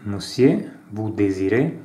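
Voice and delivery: reading out a café dialogue with light character voices; acted dialogue reading. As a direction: play both sides of the café scene, lightly. Reads French café dialogue aloud. (lettura animata di un dialogo in francese)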